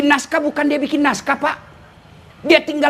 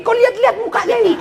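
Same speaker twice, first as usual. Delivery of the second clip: laughing mockery; 9 semitones higher, faster than usual. Real, not acted. Mocking look at candidates' faces.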